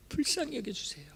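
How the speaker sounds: breathy whisper on 불쌍히 여겨주세요, falling; a soft whispered 불쌍히 여겨주세요 with a falling end; whispered plea. Acted. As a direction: whisper 불쌍히 as a hushed beg.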